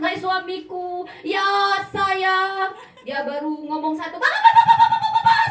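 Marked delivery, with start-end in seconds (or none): 0.0-2.7 sweet wife. Retold or imitated. 4.2-5.4 shrieked nagging, very high and loud, laughing; wife nagging her husband non-stop, very high laughing shout. Retold or imitated. Nagging Batak wife imitated.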